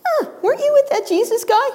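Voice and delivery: put-on high, quick accusing girl; servant girl voice. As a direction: poke at him like a nosy girl. Servant girl questions Peter.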